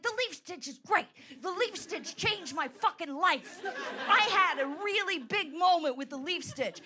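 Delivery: mock gushing. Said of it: "And I'm like".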